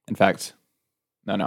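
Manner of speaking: usual speaking voice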